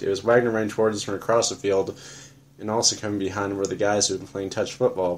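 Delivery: usual speaking voice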